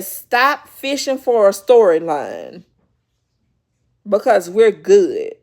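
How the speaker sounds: loud, firm mock telling-off; retold shout. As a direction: shut her down loudly. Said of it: "screamed and she said".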